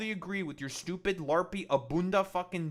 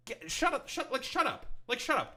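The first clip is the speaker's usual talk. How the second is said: higher pitched, incredulous shut up; exasperated shut up; 8 semitones higher than usual. Real, not acted. Streamer fed up with the clip's both-sides line, exasperated higher shut up. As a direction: repeat shut up in disbelief, voice up high. Exasperated at Ezra Klein clip.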